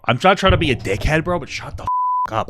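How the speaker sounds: real irritation. Real, not acted. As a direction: snap at the viewer in real annoyance. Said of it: "I'm sorry I'm getting so irritated".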